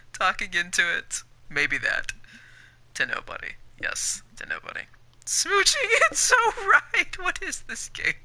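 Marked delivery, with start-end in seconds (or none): 5.3-8.1 high hammed-up lovey voice; mock swoony voice. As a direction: ham up a lovestruck sigh. Voicing a smooching phone owner.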